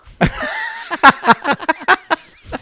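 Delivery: bursting into a loud, high laugh; cracking up at the flamenca emoji. Real, not acted. Laughing hard at his own story.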